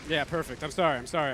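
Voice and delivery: hoarse after coughing; chesty cough. Real, not acted. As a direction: talk through a cough. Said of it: "I'm sorry for the coughing".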